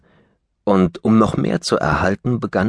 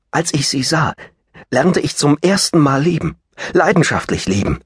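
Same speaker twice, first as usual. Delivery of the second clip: higher, swelling with ardour; passionate declaration; 7 semitones higher than usual. Acted. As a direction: declare love with rising fervour. Lapkin's love declaration.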